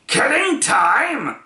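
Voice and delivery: slow, high indignant roar. A narrator's reading. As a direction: roar it back in outrage. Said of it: "roared the dog".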